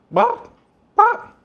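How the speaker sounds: high, loud bop, bop; penguin call. Pretend. Cooking vlogger tries a penguin call, high and loud. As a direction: pop each bop out high and loud like a bird. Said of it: "would that be the sound I make".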